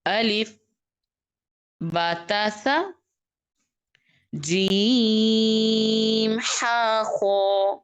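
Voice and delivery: جیم stretched very long, each letter named slowly; letter recitation. Shown as a demonstration. Teacher models the Arabic letters for her pupils, slowly. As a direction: draw out جیم, then ہا and خوا short. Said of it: "میں پڑھتی ہوں، دیکھیں۔".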